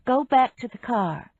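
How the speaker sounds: usual speaking voice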